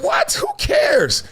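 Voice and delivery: high dismissive shout; mock shout. Vlogger shouting off insults that don't fit him, high and dismissive. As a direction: yell it off like it means nothing.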